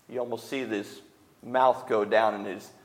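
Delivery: ordinary talk, nothing marked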